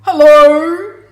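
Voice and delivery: huge, loud, drawn-out hello; roaring greeting, rising at the end; tiger voice. A narrator's reading. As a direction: boom out hello like a storybook tiger. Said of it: "says the tiger".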